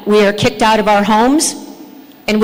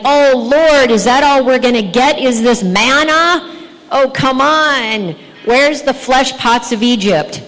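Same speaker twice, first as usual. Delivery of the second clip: mock complaining; 3 semitones higher than usual. Preacher imitating Israelites grumbling to God about manna, mock complaining. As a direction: act out an exasperated grumbler. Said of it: "and they complain".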